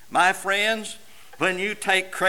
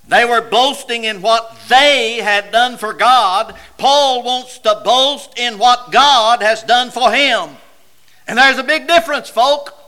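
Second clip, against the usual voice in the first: loud, emphatic pulpit voice; raised preacher's voice with heavy punches; impassioned preaching; 5 semitones higher, 8 dB louder than usual. Real, not acted. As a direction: drive the contrast home to the congregation.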